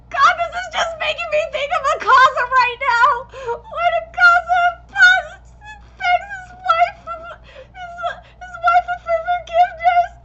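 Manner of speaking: high, loud, teary voice breaking; choked up recalling the scene; near tears. Real, not acted. Fan choking up over Akaza's backstory, high and tearful. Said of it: "I'm gonna cry just thinking about it".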